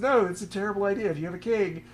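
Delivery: high, loud acted protest; Samuel objecting. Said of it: "And, and Samuel says".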